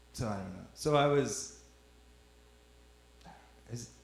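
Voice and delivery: ordinary delivery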